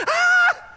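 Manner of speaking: high, loud, hammed-up scream of fright; shriek. Preacher acting out the frightened king, a loud high shriek. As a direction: let out a panicked mock shriek. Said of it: "he shrieked".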